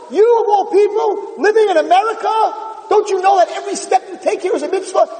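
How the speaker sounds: high, incredulous, heated outcry; indignant scream. Retold or imitated. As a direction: rail at him for living abroad. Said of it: "was screaming".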